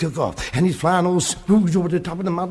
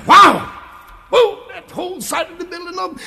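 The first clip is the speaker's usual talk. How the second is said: high, loud astonished wow; shocked shout; 12 semitones higher, 6 dB louder than usual. A narrator's reading. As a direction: gasp it out at the bursting wall. Third spook bursts in.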